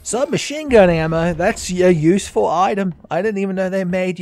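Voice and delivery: loud, high, thrilled announcement; excited. Real, not acted. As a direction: shout out the find with glee. Excited about a found item.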